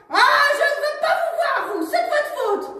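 high, loud furious rebuff; angry refusal. Acted. Cali blames Jules, high and angry. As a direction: push him away angrily.

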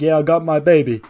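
loud, high, triumphant voice; put-on gleeful voice. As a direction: crow it out happily. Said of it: "It's like".

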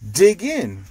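cheerful call. Acted. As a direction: call the friends in to eat, cheerfully. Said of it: "says Pete".

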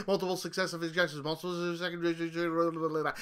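rattled off quickly, dissolving into gibberish; tongue-twister rush. Shown as a demonstration. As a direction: race through it until the words fall apart. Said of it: "Try saying that five times fast".

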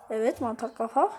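ordinary delivery

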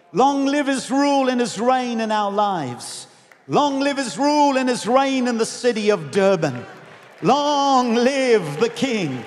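sustained, chant-like proclamation slowing at the end; rousing declaration. Real, not acted. Preacher declares the king's reign over Durban, proclaiming. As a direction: proclaim it, stretching the final words. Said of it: "What do we declare this morning".

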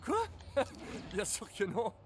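ordinary talk

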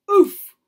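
sudden, high, very loud oof; landing grunt. Acted. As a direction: blurt it as if hitting the ground. Landing in a heap.

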